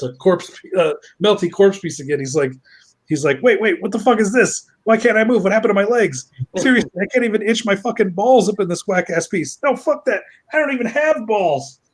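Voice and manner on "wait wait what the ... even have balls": high-pitched, mock-outraged complaining; indignant panicked outburst. Resurrected corpse discovers his legs won't move, high indignant fluster. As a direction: protest in rising, incredulous disbelief.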